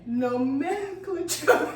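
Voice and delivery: drawn-out syllable-by-syllable reading; reading the spelling word slowly for the speller. Shown as a demonstration. Spelling bee host reads the word, drawn out slowly. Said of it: "Would you like me to sound again".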